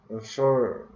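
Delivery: normal talking voice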